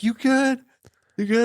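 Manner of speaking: high urgent check-in; worried partner. Retold or imitated. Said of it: "my sniper partner's like".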